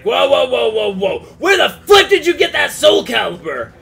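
loud alarmed whoa, whoa; startled shout. Retold or imitated. Retold guard's loud startled shout. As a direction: Bastion shouting at the stranger carrying the sword. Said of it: "he's like".

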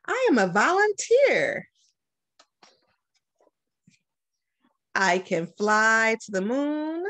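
put-on lively, sing-song read-aloud for kids; bright picture-book reading voice; reading to children. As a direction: read it like story time for young kids. Principal reads picture book aloud.

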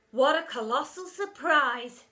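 slow, loud sarcastic chorus; sarcastic kids. A narrator's reading. Kids mocking the teacher, loud sarcasm. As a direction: drip with sarcasm. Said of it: "shouted the kids".

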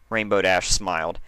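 ordinary delivery

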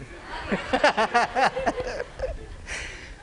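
real giggle, high and breathy; high burst of laughter. Real, not acted. Preacher laughing at his dog watching the duck show. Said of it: "could watch it".

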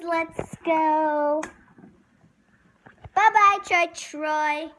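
ordinary talk

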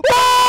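very high, loud scream of alarm; sudden full-out startled shriek; piercing scream. Real, not acted. Scream during near fall.

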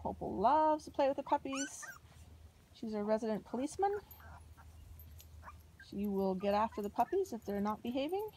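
normal talking voice

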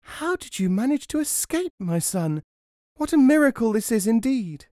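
high-pitched, astonished mother; amazed cry. A narrator's reading. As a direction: cry out at a miracle. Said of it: "she cried".

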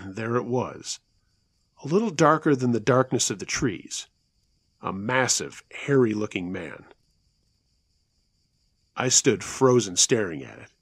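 ordinary delivery

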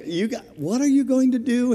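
high, loud, baffled question; incredulous. Retold or imitated. Retells his baffled reply to the diver.

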